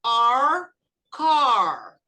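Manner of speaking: "R, car" loud with a strong curled r; loud, drawn r-colored "car"; paulista r. Shown as a demonstration. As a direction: hold the retroflex r in "car". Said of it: "tem que falar igual o paulista".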